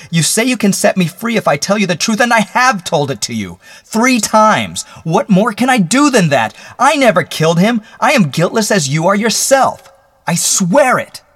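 high, loud desperate outburst; anguished shout, pitch way up; bursting forth. Acted. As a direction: explode into a frantic plea. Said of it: "Then he burst forth".

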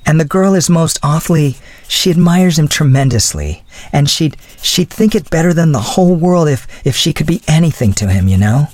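put-on high girlish voice, earnestly admiring; lightly voiced girl in love; gushing young woman. A narrator's reading.

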